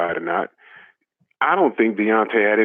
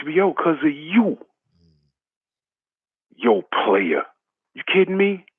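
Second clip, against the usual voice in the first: heated rant; 7 semitones higher than usual. Acted. Ranting at Paulie.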